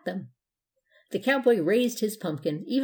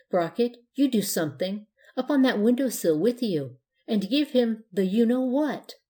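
ordinary delivery

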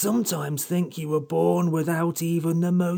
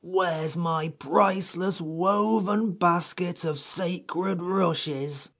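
morose; slower than usual. A narrator's reading. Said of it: "asked the Samiad morosely".

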